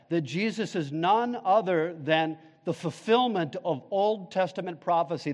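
normal talking voice

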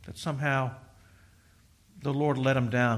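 ordinary talk

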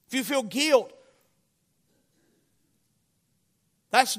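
loud, high, building to shouted commands; urgent exhortation rising to a shout; full-force preaching. Real, not acted. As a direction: preach it hard, climbing to single hammered words.

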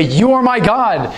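high, slow heartfelt cry to God; fervent cry. Shown as a demonstration. Preacher voices the believer's cry to God, high and slow. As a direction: cry it out slowly, lifting up. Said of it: "is to cry out and say".

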